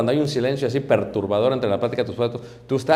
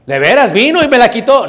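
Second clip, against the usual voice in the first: higher, louder protesting excuse; mock excuse; 9 semitones higher, 11 dB louder than usual. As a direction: insist loudly the devil took it. Mocking excuse of churchgoer.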